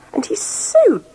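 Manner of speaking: high, squeaky lady's disgust; a fussy town lady recoiling at the giant's dirt. Acted. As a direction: wrinkle your nose as you say it. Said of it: "wrinkling up her nose".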